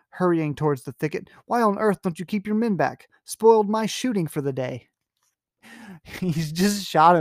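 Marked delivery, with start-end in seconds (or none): 1.5-4.9 mild anger. Acted. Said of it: "answered Sir Geoffrey angrily".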